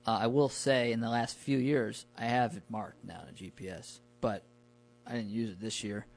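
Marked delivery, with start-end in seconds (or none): none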